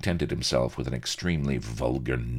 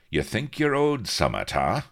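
pointed question, starting high and loud; challenging; 9 semitones higher, 5 dB louder than usual. Acted. Pirate leader tests captain on his debt, challenging. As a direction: goad him with a mild pirate challenge.

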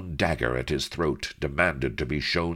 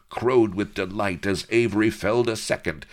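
ordinary delivery, nothing marked

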